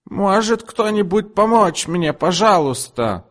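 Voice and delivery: very high, slow mock wail; wailing customer. A narrator's reading. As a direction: pretend nobody is there. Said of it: "завопила она". (очень высокий, протяжный притворный вопль)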